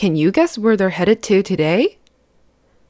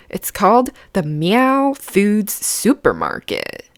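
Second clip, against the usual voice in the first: ordinary delivery, nothing marked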